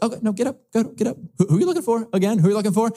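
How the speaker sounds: a light, amused Jesus hurrying the soldiers to their feet; playful Jesus. Acted. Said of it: "Jesus is like".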